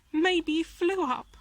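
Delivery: a sly crow dropping a hint; chuckling crow. A narrator's reading. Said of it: "One of the crows chuckled".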